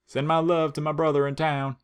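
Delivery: kindly little rock voice; sweet talking rock. Acted. Rock speaks again.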